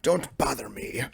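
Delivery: slow, hushed plea to be left alone; acted grumpy whisper. Sick recluse in bed warding people off, whispering. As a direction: whisper it, drawn out and withdrawn. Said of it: "I'm just like".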